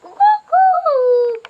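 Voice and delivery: very high, loud, slow drawn-out crowing; rooster crow imitation. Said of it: "सांग एकदा ओरड मोठ्यान".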